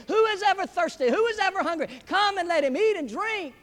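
high, loud, driving pulpit proclamation; full-force preaching. Real, not acted. Preacher urging the congregation to answer the invitation, full-force preaching. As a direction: preach it out with rising urgency.

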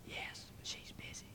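tiny hushed whisper; whispering child. Acted. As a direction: whisper it secretly like a little kid. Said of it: "Still whispering, the voice said".

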